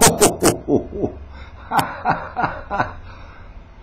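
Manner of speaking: long, high run of laughter; amused laugh. Real, not acted. Tickled by his own list of places, real laughter. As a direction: burst into a sustained high laugh.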